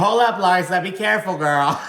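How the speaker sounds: high, loud sassy "hold up"; playful mock alarm; sassy warning. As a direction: warn her with sass. Voicing a cast member's warning.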